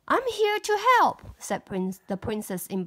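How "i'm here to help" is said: higher, louder brave hero; heroic call. Acted. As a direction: announce yourself boldly. Hero announces.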